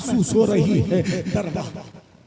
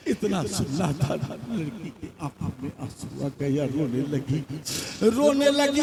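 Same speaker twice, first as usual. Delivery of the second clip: usual speaking voice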